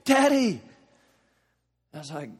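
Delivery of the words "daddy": put-on high, loud terrified child crying out; mock child screaming for daddy; child's scream. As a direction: scream it like a kid woken from a nightmare. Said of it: "he is screaming".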